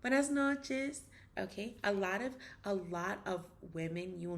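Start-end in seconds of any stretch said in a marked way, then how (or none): 0.0-1.0 Buenos dias high and bright, much higher and louder; emphatic feminine. Shown as a demonstration. Teacher showing learners the gendered, expressive way to greet. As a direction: sing out the greeting with your whole body. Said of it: "put your whole body into it".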